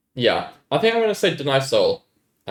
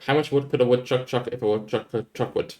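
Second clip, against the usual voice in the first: quick run through the tongue twister; faster tongue twister. Shown as a demonstration. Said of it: "should I say that faster?".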